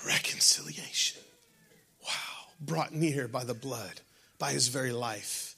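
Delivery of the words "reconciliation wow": whispered, breathy "Reconciliation.". Shown as a demonstration. Pastor repeating the key word to the church, whispered.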